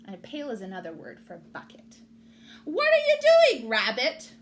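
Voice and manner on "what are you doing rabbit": high, loud call across the meadow. A narrator's reading. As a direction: call out to Rabbit, much higher. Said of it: "Mouse called".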